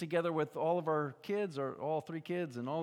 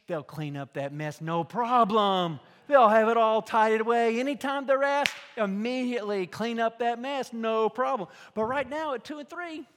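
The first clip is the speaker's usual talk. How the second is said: loud, rising preacher's cry; preaching at full force; 7 semitones higher, 13 dB louder than usual. Acted. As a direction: hammer it home from the pulpit.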